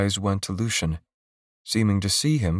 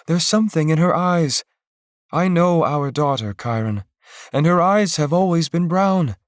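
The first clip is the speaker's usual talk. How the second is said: higher, louder pleading wife; wife urging her husband to see the change in their daughter; urgent mother voice; 9 semitones higher, 4 dB louder than usual. Acted. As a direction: press him to listen, rising toward the end. Wife urges husband to listen.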